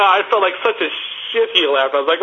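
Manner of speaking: usual speaking voice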